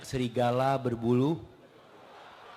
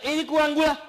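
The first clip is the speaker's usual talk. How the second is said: grumpy husband; 12 semitones higher, 6 dB louder, faster than usual. Retold or imitated. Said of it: "Suami ngata".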